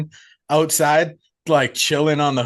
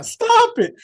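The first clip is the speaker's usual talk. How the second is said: mock screaming. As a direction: imitate her screaming in horror, pitched way up. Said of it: "Phoebe is screaming about the blood".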